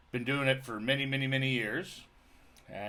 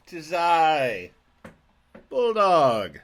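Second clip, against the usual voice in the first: high, loud theatrical greeting; grand announcer voice; 6 semitones higher, 5 dB louder, slower than usual. Acted. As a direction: boom it out like a showman. Podcast host's grand put-on intro.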